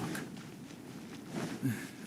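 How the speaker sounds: a quiet, breathy sigh of mild weariness; soft sigh. Acted. Commissioner sighing after empty small talk, soft sigh. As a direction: let the air out, tired of small talk.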